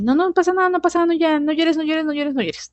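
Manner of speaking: put-on high, coaxing mom voice hushing a crying kid; high-pitched comforting mom imitation; mother soothing child. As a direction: mimic a mother calming her fallen little one.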